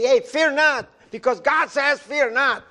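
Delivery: preacher's shout. Acted. Preacher urging the congregation to fear not, mild high shout. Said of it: "come here and shout and say".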